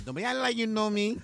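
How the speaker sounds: hammed-up Spanish accent, high. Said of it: "She's got that little accent".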